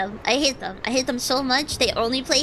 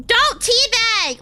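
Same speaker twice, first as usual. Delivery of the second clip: loud, high, drawn-out order; exasperated yell; 6 semitones higher, 5 dB louder, slower than usual. Real, not acted. Gamer scolding a teammate, exasperated yell. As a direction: yell at them to quit it.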